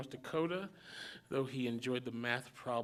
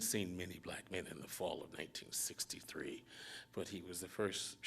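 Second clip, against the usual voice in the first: soft, breathy near-whisper; hushed narration; 6 semitones lower, 9 dB quieter than usual. A narrator's reading. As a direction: lean in and whisper it. Narration drops to hush.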